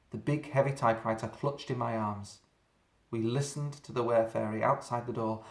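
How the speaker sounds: plain reading voice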